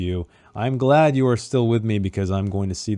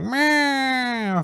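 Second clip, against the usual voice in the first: mock cat meow. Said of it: "So he's just like".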